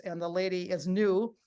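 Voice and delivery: ordinary talk, nothing marked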